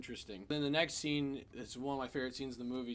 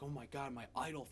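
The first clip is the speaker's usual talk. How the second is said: awed hush; 8 dB quieter than usual. Retold or imitated. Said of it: "He's like".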